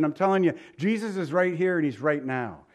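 normal talking voice

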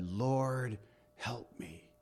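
soft, hushed and heartfelt; a humble whispered cry for help; sincere plea. Shown as a demonstration. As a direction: pray it quietly, really meaning it. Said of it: "it wasn't real until she said".

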